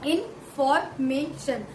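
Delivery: इन्फॉर्मेशन slowly as इन-फॉर-मे-शन; slow syllable breakdown. Shown as a demonstration. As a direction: draw इन्फॉर्मेशन out, one syllable at a time. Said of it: "अब मैंने बताया इन्फॉर्मेशन". (इन्फॉर्मेशन धीरे धीरे, शब्दांश अलग करके)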